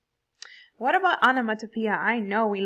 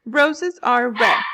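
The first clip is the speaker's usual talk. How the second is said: "Roses are red" slow and loud, beat by beat; stressed syllables; 9 dB louder, slower than usual. Shown as a demonstration. Teacher demonstrating meter to class, "Roses are red" in slow loud stresses. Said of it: "Look at the stressed and unstressed syllables".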